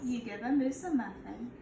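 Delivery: put-on storybook read-aloud for kids; a gentle teacher reading to her class; reading to children. Teacher reads picture book aloud.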